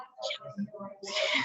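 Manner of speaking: sudden hiccup; a small involuntary hiccup. Real, not acted. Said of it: "I have the hiccups".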